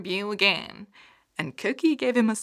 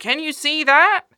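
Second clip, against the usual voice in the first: excited call; 9 semitones higher, 13 dB louder than usual. Acted. Bunny spots something, excited.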